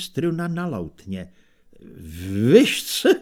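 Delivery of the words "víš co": high, loud stammered blurt; babbling. A narrator's reading. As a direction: blurt it out haltingly. (vyhrknuté zablekotání)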